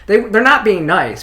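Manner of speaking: emphatic retold protest; insistent denial. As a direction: push back hard on them. Insisting against people's doubts.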